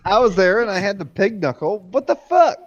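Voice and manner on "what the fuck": high, loud what-the-fuck; sudden high-pitched shock; shocked outburst. Real, not acted. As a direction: blurt it out in real disbelief. Shock at pig knuckle memory.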